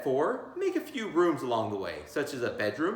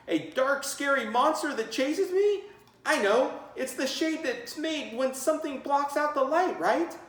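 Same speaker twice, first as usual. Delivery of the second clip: put-on kid voices; 11 semitones higher than usual. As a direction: blurt answers like eager kids. Students answering teacher.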